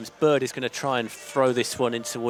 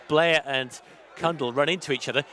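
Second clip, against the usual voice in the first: ordinary delivery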